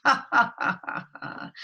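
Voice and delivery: loud acted ha-ha laugh; mocking big-beast laugh, dying away; lion's laugh. Lion laughing at the mouse's offer, hammed-up laugh. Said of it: "Lion laughed".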